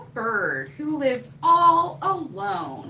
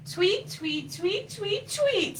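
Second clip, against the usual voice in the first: chirps rising very high; baby bird tweet; 8 semitones higher, slower than usual. Acted. Baby bird number five calling, rising high tweets. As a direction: chirp higher each time. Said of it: "said baby bird number five".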